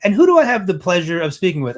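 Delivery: a hint of a grand hostess, high, loud and quick; gracious event planner. Event planner asking who approached her, lightly voiced gracious hostess. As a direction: greet a stranger with showy politeness.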